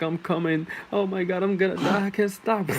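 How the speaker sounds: voicing the woman. Retelling the girl in bed, a high mock squeal. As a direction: squeal it high like she can't hold back.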